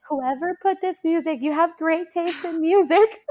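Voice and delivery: high, loud, laughing through tears; tearful laughing thanks. Retold or imitated. To the OR staff about the music, teary laughing praise. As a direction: gush thanks while half crying with joy. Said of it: "And I said".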